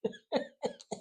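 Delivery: very high squealed giggle; high embarrassed squeal-laugh. Real, not acted. Laugh after confession.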